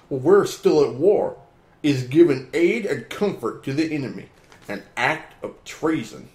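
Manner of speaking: slow, emphatic read-aloud; dramatic reading. Acted. Reading an opinion piece aloud.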